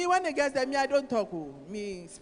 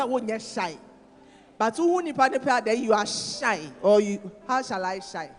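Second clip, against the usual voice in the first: louder, rising sermon delivery; preaching; 4 semitones higher, 5 dB louder than usual. Acted. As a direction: press the point home to the congregation. Preaching at full force.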